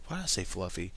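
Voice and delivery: ordinary delivery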